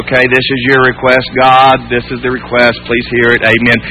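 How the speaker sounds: toneless low recitation; low, monotone, by rote; mechanical rote prayer. Acted. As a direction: rattle off a request with no feeling. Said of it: "it was without feeling".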